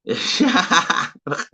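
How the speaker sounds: high burst of laughter; amused laugh. Real, not acted. Streamer reacting to viewers' tea plans, amused high laugh. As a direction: laugh out at the chat. Amused by chat's dinner replies.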